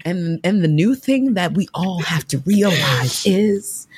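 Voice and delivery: slow, lightly mocking motivational speaker; guru voice. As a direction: mimic a slow self-help guru. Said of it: "we're like".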